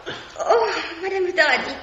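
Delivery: high, loud startled laughter. Acted. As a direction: jump with fright, then laugh. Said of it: "vous m'avez fait peur". (surprise effrayée avec un rire aigu)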